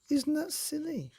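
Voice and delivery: sing-song silly question; playful question. A narrator's reading. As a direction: pop it up high to the kids. Narrator asks children playfully.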